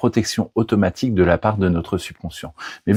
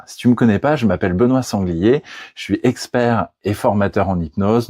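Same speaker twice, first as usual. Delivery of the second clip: normal talking voice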